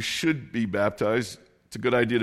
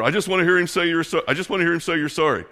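fast, high, loud mock yell; imitated angry yelling; 6 semitones higher, 4 dB louder, faster than usual. Enraged driver yelling at the wife, mock angry yell. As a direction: imitate a fuming driver, raised pitch and rushed. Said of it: "he's yelling at her".

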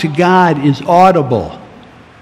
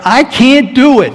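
high, louder cry of helplessness; desperate admission; 9 semitones higher, 4 dB louder than usual. Acted. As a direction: plead you can't manage alone. Humble admission in prayer.

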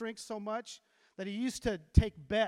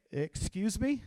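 drawn-out indignant question with a rising end; affronted, rising "excuse me?"; challenging retort; slower than usual. Retold or imitated. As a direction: throw it back as a challenge. Bob's defiant catchphrase acted out.

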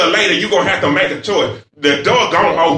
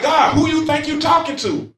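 higher, mock defiant challenge to God; indignant Cain; 7 semitones higher than usual. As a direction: play Cain squaring up to God, half-acted. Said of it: "That's how Cain was talking to God".

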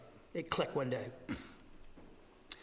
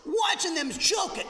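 much higher and louder, fed-up fan venting; exasperated outburst; 10 semitones higher, 6 dB louder than usual. Real, not acted. Pastor venting to the congregation about the Celtics loss: exasperated loud outburst. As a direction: blow up at the team that choked.

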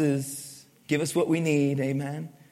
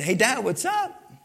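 breezy hello to Dad, pitched up at the end; easy, informal phone-call hello, higher in pitch; casual greeting; 4 semitones higher than usual. Acted. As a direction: greet your dad casually, lifting into the question. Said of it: "I just say".

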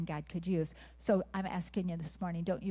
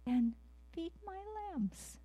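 voice of God; 11 semitones higher, slower than usual. Acted. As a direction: give the final charge high and slow, almost floating. Voicing God's commission.

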